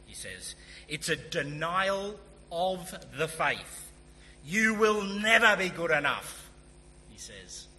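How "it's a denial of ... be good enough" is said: booming, higher preacher voice, slow and emphatic; Martin Lloyd-Jones. Retold or imitated. Preacher quotes a great predecessor on not being good enough, booming with authority. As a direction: build to a loud, authoritative verdict. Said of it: "he's a man of great authority".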